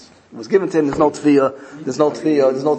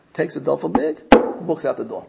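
usual speaking voice

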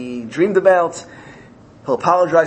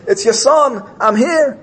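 high, loud eager call; urgent calling out; 9 semitones higher, 5 dB louder than usual. Acted. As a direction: call out to a father in a passing carriage. Son calls to king's carriage.

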